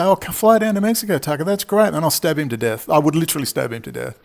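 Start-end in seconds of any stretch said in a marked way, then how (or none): 0.0-1.9 fake friendly. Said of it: "I'll say".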